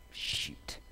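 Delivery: breathless whispered "Shoot"; whispered curse. Real, not acted. After hearing Sean died, a whispered curse. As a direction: let it slip out under your breath.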